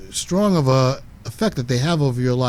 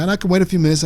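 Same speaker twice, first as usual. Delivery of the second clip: ordinary delivery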